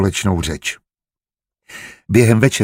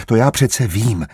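ordinary delivery

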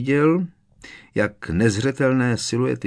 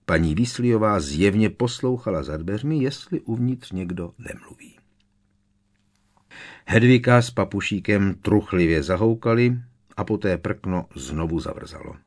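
ordinary delivery

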